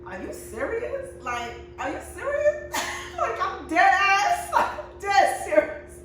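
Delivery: laughing imitation. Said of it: "he's just like".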